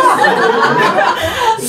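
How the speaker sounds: high burst of laughter; real laughter. Real, not acted. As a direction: crack up mid-story. Cracking up at own story.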